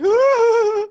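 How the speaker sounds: a loud, high mock wail; imitated wail. Retelling the crying scene with a loud mock wail. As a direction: ham up a bawling man's wail. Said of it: "crying. He's like".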